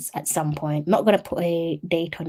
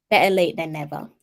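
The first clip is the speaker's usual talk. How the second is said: normal talking voice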